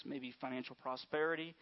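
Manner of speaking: ordinary talk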